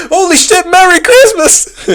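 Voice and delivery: excited shout. Retold or imitated. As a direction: shout it like a thrilling surprise. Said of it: "and then Axel was like".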